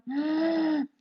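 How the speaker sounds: soft inhaled gasp through the throat; gentle gasp. Shown as a demonstration. Teacher demonstrates the Ujjayi inhale, soft gasp. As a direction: draw breath in with a little gasp. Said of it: "the surprise sound, but mouth closed".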